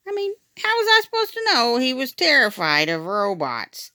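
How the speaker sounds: plain reading voice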